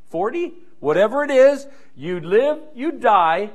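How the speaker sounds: ordinary delivery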